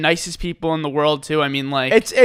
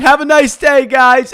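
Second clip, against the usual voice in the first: mild mock yell, higher and louder; retold scream; 5 semitones higher, 5 dB louder, slower than usual. Worker calling after departing customers, mild retold scream. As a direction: call it out after them, raised but controlled. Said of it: "I screamed".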